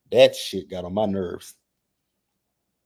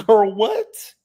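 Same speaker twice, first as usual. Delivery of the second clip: high loud disbelief; shocked; 12 semitones higher, 6 dB louder than usual. Real, not acted. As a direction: squeal it in shock. Shocked outburst.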